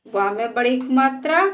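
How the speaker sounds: ordinary talk, nothing marked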